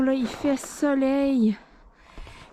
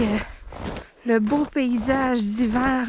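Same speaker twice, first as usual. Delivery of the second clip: ordinary delivery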